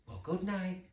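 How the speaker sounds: plain reading voice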